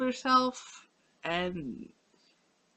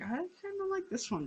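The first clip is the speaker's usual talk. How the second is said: higher voice; 11 semitones higher, 7 dB quieter than usual. Pretend. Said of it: "I get, like, a distinct, like".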